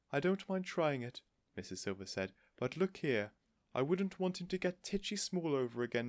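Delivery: a light worry; worried Mrs Silver. A narrator's reading.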